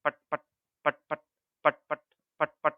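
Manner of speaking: crisp, rhythmic pat pat; teacher imitating slippers; imitating slipper claps. Shown as a demonstration. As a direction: clap out the heel sound with the voice. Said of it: "तो कैसी आवाज़ करती है?".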